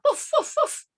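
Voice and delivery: high yappy woofs; puppy barks. Acted. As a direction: bark like a tiny puppy.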